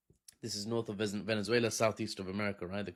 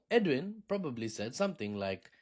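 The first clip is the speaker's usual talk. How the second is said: ordinary delivery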